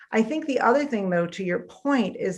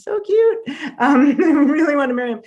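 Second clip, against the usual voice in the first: high, louder, laughing through it; giggly gushing; 7 semitones higher, 6 dB louder than usual. Real, not acted. Recalling her childhood crush to the host, giggling and gushing. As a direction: gush about him, giggling.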